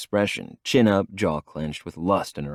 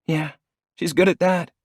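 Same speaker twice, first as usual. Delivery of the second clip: higher, dry scoff; 11 semitones higher, 4 dB louder than usual. A narrator's reading. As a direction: scoff at her other self. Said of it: "she scoffs".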